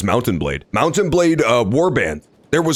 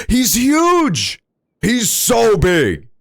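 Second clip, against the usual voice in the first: delighted; 9 semitones higher, much slower than usual. Real, not acted. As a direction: gush over how big he is. Delighted by giant character.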